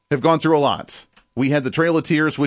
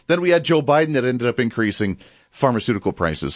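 ordinary talk

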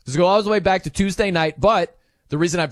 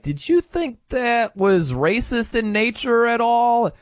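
higher, slow, drawn-out reporter voice; imitated reporter; 6 semitones higher, slower than usual. Reporter asking the player after the game, imitated probing question. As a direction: put on a slow, high questioner's voice.

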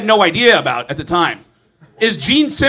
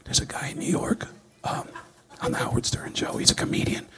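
hushed, breathy tip-off; secretive whisper; 6 semitones lower, 10 dB quieter than usual. Retold or imitated. Someone secretly tipping off Gene, whispering. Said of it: "someone finally told him".

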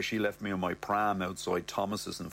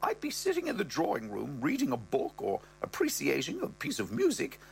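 higher mother voice, rising high at the end; mother's voice; 11 semitones higher than usual. Acted. Mother tells Brett about Ross's libido, in a higher voice. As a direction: voice the mother, pitched up and prim.

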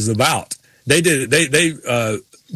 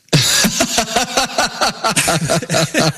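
real burst of laughter; laughing; 5 semitones higher than usual. Real, not acted. Hosts cracking up at the Ward Burton story, real laughter. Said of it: "laughter after quote".

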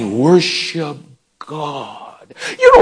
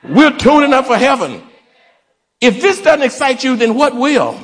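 fired-up preaching; 6 semitones higher than usual. Real, not acted. Preacher hyped after call-and-response.

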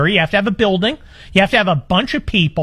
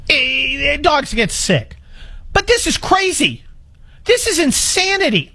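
pitched way up in disbelief; exasperated; 7 semitones higher than usual. Real, not acted. Host ranting to listeners about vet bills, exasperated. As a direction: throw up your hands at the absurdity.

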